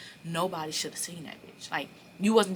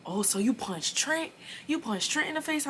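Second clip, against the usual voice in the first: higher-pitched mimicry of excited coworkers; pry with a raised, incredulous question; nosy gossip; 9 semitones higher than usual. Coworkers asking about the punch on Trent, nosy and excited.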